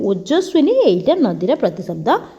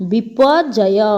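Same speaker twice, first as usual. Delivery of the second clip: slow and clear; much slower than usual. Shown as a demonstration. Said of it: "next ଆସିଲା".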